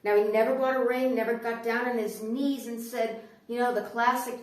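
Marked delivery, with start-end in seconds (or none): none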